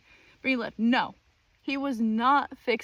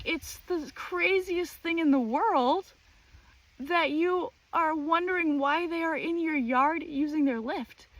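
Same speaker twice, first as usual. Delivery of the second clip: mock outrage; 7 semitones higher than usual. Mocking neighbor's outrage.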